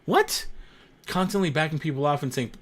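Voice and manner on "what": sharp high incredulous what; can't believe it; disbelief. Real, not acted. Streamer hearing people ride a wolfhound, squeaky disbelief.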